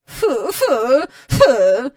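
repeated huffy exclamation; exclaimed disgust. A narrator's reading. Miss Andrew marching angrily to the house, exclaiming. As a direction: puff out disapproval in bursts. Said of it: "раз у раз вигукуючи".

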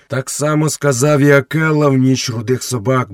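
ordinary delivery, nothing marked

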